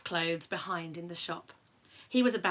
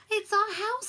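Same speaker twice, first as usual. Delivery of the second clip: much higher squeaky mother mouse; mouse squeak; 10 semitones higher, 4 dB louder than usual. A narrator's reading. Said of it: "squeaked the mother mouse".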